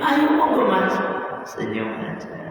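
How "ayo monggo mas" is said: coaxing host. Acted. Guest offering food to angels.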